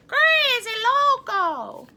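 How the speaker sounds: slow, loud and high. Shown as a demonstration. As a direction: stretch out crazy loco with gusto. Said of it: "You wanna say that with me?".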